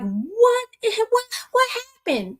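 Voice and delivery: high, stunned question; shocked disbelief. Retold or imitated. Wondering what happened to the neighbor, stunned. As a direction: ask it in sudden disbelief.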